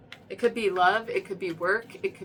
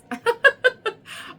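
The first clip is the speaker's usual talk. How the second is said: bright high burst of laughter; 7 dB louder than usual. Real, not acted. Laughs after scolding viewers.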